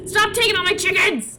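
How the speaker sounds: high, loud protest; indignant yell. Real, not acted. Gamer objects to losing her chickens, indignant yell. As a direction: yell it in high-pitched exasperation.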